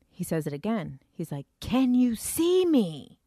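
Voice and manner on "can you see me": slow, loud, higher shouted question; retold scream. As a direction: yell it slowly right into his face. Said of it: "He's screaming in my fucking face".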